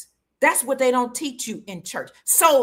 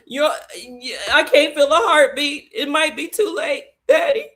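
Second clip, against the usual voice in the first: crying in panic; 3 semitones higher, 4 dB louder than usual. Retold or imitated. Daughter fearing her daddy has died, crying in panic. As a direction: let the voice break upward in desperation. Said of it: "And I'm crying".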